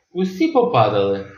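plain reading voice

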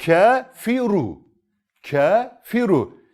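slow recited "Kafiru" with heavy ra; thick ra recitation. Shown as a demonstration. Teacher reciting the example word slowly for students. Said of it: "Sen de kalın okuyorsun".

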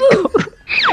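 squealing laugh in a panic; shrill burst of nervous laughter; high panicked laugh. Real, not acted. Panicked laugh mid fight.

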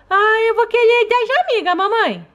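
put-on high childlike voice of a small daughter; little girl voice. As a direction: play the sick little girl talking to mom. Doll chooses.

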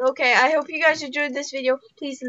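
fast throwaway outro line; rushed outro. Real, not acted. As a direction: rattle off the sign-off. Rushed outro mid-game.

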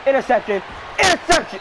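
excited game call. Real, not acted. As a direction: build from a call to an eager yell. Calling a hoped-for pick in game.

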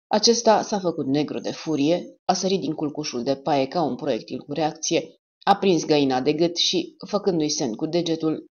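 ordinary delivery

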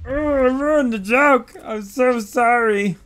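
high, whiny fake lament; mock wailing. Player apologizing for a botched joke, mock wailing. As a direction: wail an apology as if heartbroken.

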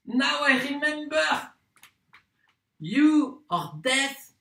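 high, exclaiming burst of discovery; excited realisation. Acted. Lodger realises the landlady is deaf and dumb, excited. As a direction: cry it out as the truth suddenly dawns.

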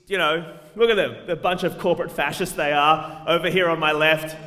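usual speaking voice

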